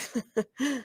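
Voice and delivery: mean little snicker. A narrator's reading. Bully snickering at a classmate's drawing. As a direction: snicker at the notebook page. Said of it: "and snickered".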